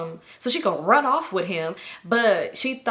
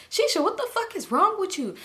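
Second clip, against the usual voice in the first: high-pitched indignant demand; retold telling-off, pitched way up; exasperated scolding; 11 semitones higher than usual. Friend confronting Shisha on the phone, high exasperation.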